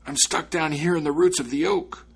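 high, loud, strained spirit voice; trapped voice calling. A narrator's reading. Trapped spirit answering the boy from the oak roots, calling out. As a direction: call up from where you are stuck. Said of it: "the voice answered".

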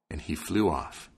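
plain reading voice

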